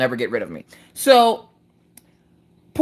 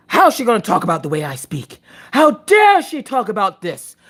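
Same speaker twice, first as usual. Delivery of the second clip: hammed-up offended protest; indignant; 3 semitones higher than usual. Acted outrage at commenter.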